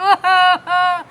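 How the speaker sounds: pulsing mock sobs; imitated crying. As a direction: sob theatrically for the retelling. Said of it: "like, crying".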